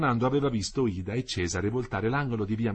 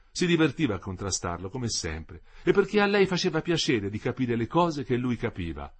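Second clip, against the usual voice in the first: ordinary delivery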